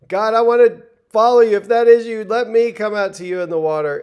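high, loud eager cry to God; a fervent, excited prayer; eager plea. Retold or imitated. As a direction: burst out like you're ready to jump. Eager believer voiced stepping out.

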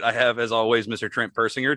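ordinary talk

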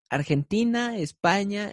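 usual speaking voice

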